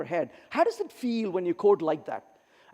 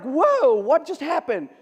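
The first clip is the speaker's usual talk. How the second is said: high, loud startled question; mock bewilderment; surprised whoa; 4 semitones higher, 4 dB louder, slower than usual. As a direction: caught off guard in the theater. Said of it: "I'm like".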